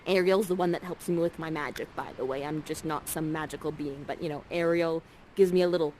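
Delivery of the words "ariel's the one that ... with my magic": normal talking voice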